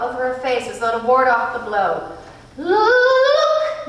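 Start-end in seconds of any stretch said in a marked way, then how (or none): 2.6-3.9 high, loud, sung-out order; command. A narrator's reading. Mrs Which ordering Meg to look, in a high, loud, sung command. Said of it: "Missus Witch commanded".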